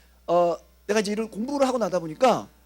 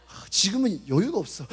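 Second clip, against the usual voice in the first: ordinary talk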